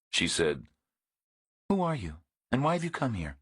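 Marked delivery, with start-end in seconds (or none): 1.7-3.4 lightly voiced woman, higher, quick and wary; suspicious woman. A narrator's reading. Woman demands who Reacher is, quick suspicious higher voice. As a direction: press him fast, a touch higher.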